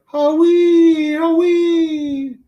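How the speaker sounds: high, loud hooting howee snore. Retold or imitated. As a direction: hoot the snore up high like a silly owl. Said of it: "Other times it's like".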